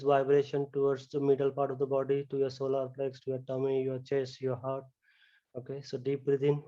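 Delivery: soft, hushed meditation voice; guided relaxation. Acted. Yoga teacher guiding students lying on the floor, soft hushed voice. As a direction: ease the class down into stillness, softly.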